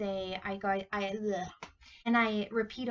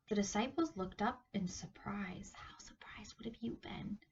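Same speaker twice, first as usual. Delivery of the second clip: breathy hushed whisper; 8 dB quieter than usual. A narrator's reading. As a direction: whisper it like a secret. Storyteller keeps whispering.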